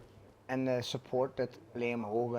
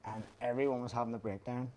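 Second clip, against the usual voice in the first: ordinary talk, nothing marked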